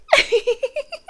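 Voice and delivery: a short laugh pitched well up; amused high chuckle. Acted. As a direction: laugh lightly at your own joke. Captor laughing at own joke.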